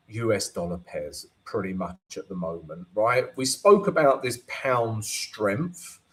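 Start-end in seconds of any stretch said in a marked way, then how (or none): none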